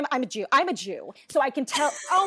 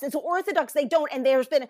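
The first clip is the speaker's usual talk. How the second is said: ordinary talk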